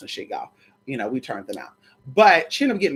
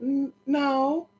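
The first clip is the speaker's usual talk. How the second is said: soft, higher coy voice; coy refusal; 6 semitones higher, 6 dB quieter, much slower than usual. Retold or imitated. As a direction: play shy and hesitant, quiet. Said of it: "And I'll say".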